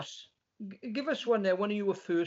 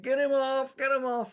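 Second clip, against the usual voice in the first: hammed-up heckling crowd, higher and louder; mock heckle; 7 semitones higher, 3 dB louder than usual. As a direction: shout "get him off" like a heckler in a northern club. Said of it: "comedian up in the clubs up north".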